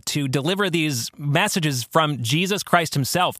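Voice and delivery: ordinary talk, nothing marked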